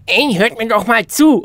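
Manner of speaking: loud, high, forceful attention-getter; emphatic demo call. Shown as a demonstration. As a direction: call out loudly to grab everyone's attention. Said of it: "Es ist ein Unterschied, wenn ich sage".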